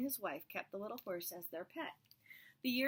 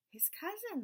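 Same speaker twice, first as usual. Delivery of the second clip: much higher "His cousin"; eureka; 12 semitones higher than usual. Acted. Wise men realizing the answer, pitched much higher. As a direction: blurt out the sudden idea.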